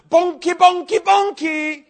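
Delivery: high, loud prophetic yell; yelling a prophecy. Retold or imitated. Preacher retells yelling a prophecy at the fire tunnel, loud and high. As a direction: belt it out like a prophecy pouring out. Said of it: "I was started yelling".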